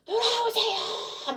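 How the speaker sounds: high, drawn-out wail of a call; imitated echoing cry. Crowd's plea echoing back to the preacher, imitated high wailing cry. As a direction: imitate a far-carrying plea, slowly stretched. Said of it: "메아리처럼 막 오는 게".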